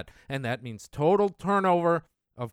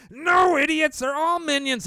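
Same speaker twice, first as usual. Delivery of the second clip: much higher and louder scolding yell; angry shout; 11 semitones higher, 5 dB louder than usual. Real, not acted. Scolding naive voters with a loud high shout. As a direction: bark it at the fools.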